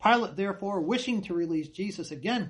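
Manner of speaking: normal talking voice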